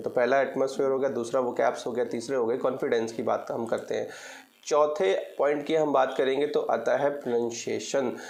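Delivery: ordinary talk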